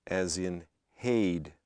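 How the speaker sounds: haid gliding from e to i, slow and clear; slow diphthong demo in haid. Shown as a demonstration. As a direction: stretch haid into an ay glide. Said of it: "pronounced as a diphthong".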